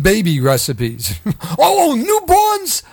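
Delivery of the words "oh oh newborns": high, laughing mock shock; mock surprise. Real, not acted. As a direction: feign horror at the joke.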